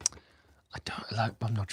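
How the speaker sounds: whispered aside to herself. Real, not acted. Streamer whispers to herself about owning a tie, whisper. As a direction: drop to a breathy private whisper.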